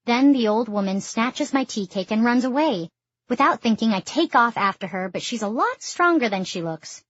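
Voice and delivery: plain reading voice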